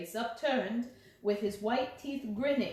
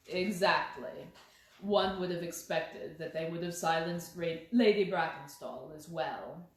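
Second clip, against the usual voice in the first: lower, measured detective voice; lightly voiced Holmes, calm and lower; 5 semitones lower than usual. A narrator's reading. Holmes coolly answering the inspector, in a lower measured voice.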